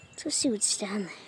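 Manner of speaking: quick secretive whisper. Pretend. A kid sneaks a look under the trampoline, fast whisper.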